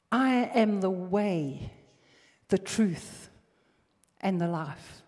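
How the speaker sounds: slow, weighty declaration; slow proclamation. Acted. Preacher proclaiming Jesus' words slowly and firmly. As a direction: lay each word down.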